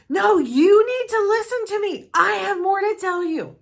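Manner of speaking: father insisting on being heard; insistent dad. Retold or imitated. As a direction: cut in hard as the upset father. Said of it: "father cutting in".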